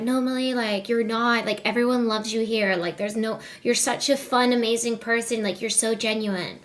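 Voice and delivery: soft, hushed Sinner impression; a hint of Sinner, said quietly and soothingly; imitating Sinner. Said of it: "Sinner impression".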